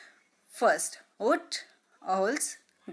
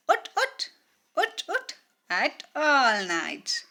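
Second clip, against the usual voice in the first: hoot; 5 semitones higher than usual. Shown as a demonstration.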